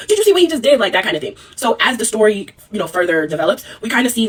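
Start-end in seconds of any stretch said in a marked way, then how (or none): 0.1-0.8 outraged. Retold or imitated. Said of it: "continues going off like".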